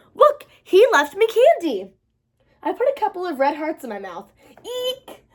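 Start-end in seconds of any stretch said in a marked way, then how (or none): 0.1-1.9 high, loud, slow delight; thrilled to show the candy; excited. Acted. Girl showing brother Cupid's candy, high excited. 4.6-5.2 eek. Acted. Tastes hot candy.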